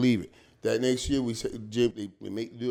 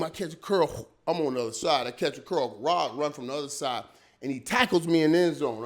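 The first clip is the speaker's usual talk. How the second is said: ordinary talk, nothing marked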